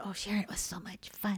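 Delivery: soft whisper, breathy and unvoiced. Mother recalling the honey prank, whispered fondly. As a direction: breathe it out like a secret delight.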